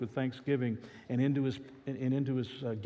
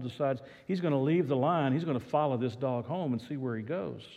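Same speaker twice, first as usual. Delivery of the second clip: ordinary talk, nothing marked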